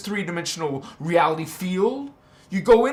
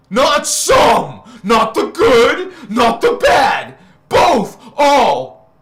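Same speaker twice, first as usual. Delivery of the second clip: ALL shouted high and loud, slowly; preaching shout; 5 semitones higher, 10 dB louder, much slower than usual. Real, not acted. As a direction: roar it out like a preacher at full force.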